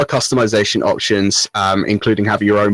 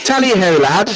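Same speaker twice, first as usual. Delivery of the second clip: British English; 8 semitones higher than usual. Acted. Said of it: "But in full British English".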